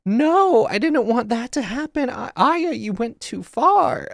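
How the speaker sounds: high, whiny, slowed protest; shocked daughter. Retold or imitated. Mocking the daughter scolding Aya, high and whiny. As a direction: whine that Aya went too far. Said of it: "the daughter was so surprised".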